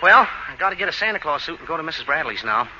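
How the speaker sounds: low, soft private aside; musing to himself. Acted. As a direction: think aloud quietly, pitch sinking. Talking to himself between scenes.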